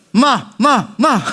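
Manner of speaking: high, sharp barking voice; Gyeongsang dog bark. Retold or imitated. Preacher imitating a Gyeongsang dog's bark, high and sharp. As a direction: snap out each "마" like a dog. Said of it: "경상도 개는 이렇게 짖는데요".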